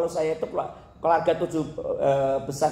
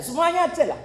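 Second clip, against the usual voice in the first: mock high quip; 13 semitones higher than usual.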